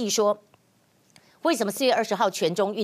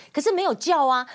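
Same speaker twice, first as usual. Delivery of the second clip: usual speaking voice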